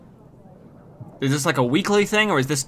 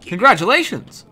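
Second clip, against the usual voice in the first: high, loud, eager father; excited father of bride; 4 semitones higher, 4 dB louder than usual. Acted. Father of the bride begging for funds, loud and eager. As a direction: burst out with fatherly joy. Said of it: "I'm gonna do a different one for him".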